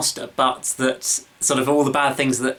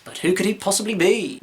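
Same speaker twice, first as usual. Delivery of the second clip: mock mystery; 7 semitones higher than usual. Teasing a mystery reveal.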